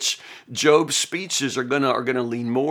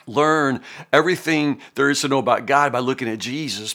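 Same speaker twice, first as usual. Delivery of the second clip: normal talking voice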